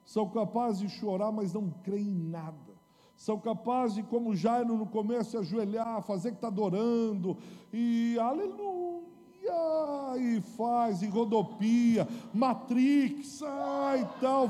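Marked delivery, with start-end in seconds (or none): 3.2-14.5 loud, higher mocking rant; hammed-up scorn of fake praise; mocking fake worship. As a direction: lampoon the showy worshippers at full voice.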